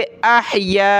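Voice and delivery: अहया with the final आ stretched long; tajweed madd demo. Shown as a demonstration. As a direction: lengthen the या in अहया. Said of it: "यहाँ पे मद करेंगे".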